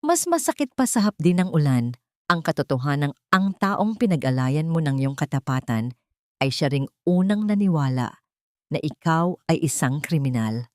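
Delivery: usual speaking voice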